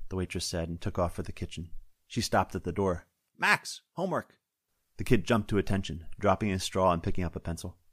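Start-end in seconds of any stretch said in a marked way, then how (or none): 3.4-4.4 higher, slower mother's call across the room; a firm call to get back to work; calling to her son. Acted. Waitress calling her son from the kitchen door, higher and slow.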